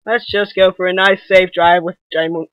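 usual speaking voice

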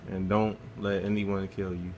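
ordinary talk

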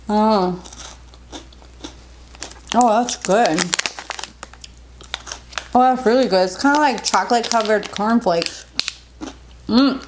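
pausing to chew food; talking while chewing. Real, not acted. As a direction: mouth partly full. Seen 3: Food goes in at -3; chewing movements and finger at mouth through ~7s.

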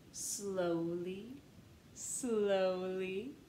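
drawn-out slowly, slowly. Acted. Reader stretching the words as the peddler walks slowly. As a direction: stretch each slowly like his steps. Reading the word to match.

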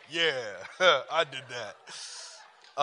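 drawn-out, self-satisfied boast; swelled up, proud. Retold or imitated. Man praised for his trim work, mock-smug. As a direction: savor it slowly with a small laugh. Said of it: "it was just swelled up".

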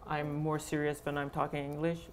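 ordinary delivery

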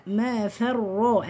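"ما فرا" slow, thin "fe", full "rro"; light fa, heavy ra. Shown as a demonstration. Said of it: "الفاء مرققة والراء مفخمة".

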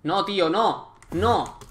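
sharp loud no, no; alarmed shout. Real, not acted. Gamer yelling no at a creeper, alarmed shout. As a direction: cry out in alarm at the mob.

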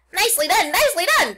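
high, loud squawked praise; goose cheering. A narrator's reading. The goose cheering Wilbur's dodge, high loud cries. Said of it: "cried the goose".

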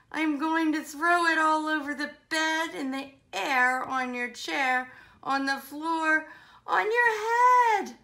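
mock-cross threat building to a high peak; fed-up outburst. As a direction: scold in exasperation, climbing to the last word. Fed-up playmate threatens to throw spaghetti.

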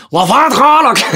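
loud, high mock French Canadian accent. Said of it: "when you're French Canadian, it sounds like".

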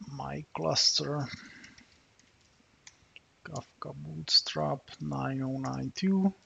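ordinary talk

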